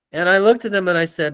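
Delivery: ordinary talk, nothing marked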